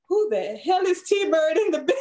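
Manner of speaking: asked through a laugh; half-laughing, baffled question; laughing disbelief. Retold or imitated. Cousin's confused reply, laughing.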